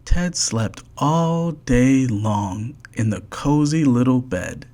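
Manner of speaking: plain reading voice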